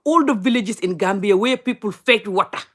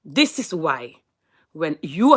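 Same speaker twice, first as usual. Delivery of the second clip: ordinary talk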